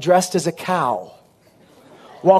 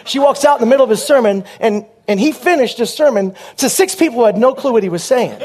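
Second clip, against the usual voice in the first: normal talking voice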